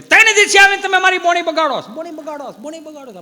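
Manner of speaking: high, loud angry yell with fading echo; furious shout. Acted. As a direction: blow up at the pestering old man. Shopkeeper explodes at old man. (ગુસ્સામાં ઊંચે સાદે બરાડો, પછી પડઘો)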